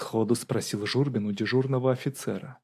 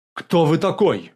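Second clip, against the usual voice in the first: loud, higher demand; alarmed challenge; 6 semitones higher, 9 dB louder than usual. A narrator's reading. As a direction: challenge the intruder sharply. Officer challenges intruder running in. (громкий окрик)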